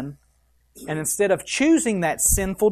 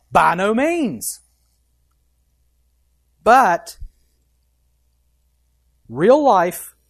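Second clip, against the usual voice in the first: full-force preaching; 4 semitones higher, 7 dB louder than usual. Acted. Preaching climax on fighting sin.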